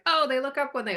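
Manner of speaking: high-pitched imitated 'oh!' moment; mock sudden realisation. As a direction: voice a naive first thought, pitched well up. Voicing own first mistaken thought.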